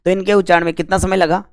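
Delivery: ordinary delivery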